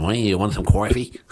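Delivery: playful Boston local offering coffee; Boston accent. Pretend. Host jokes about Boston, in a hammed-up Boston accent. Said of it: "it's always a Boston".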